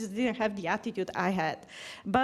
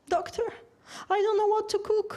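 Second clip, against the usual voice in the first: voiced higher as a helpless patient; plaintive patient; 9 semitones higher than usual. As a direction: mimic the worried patient's whine. Patient complaining to the doctor.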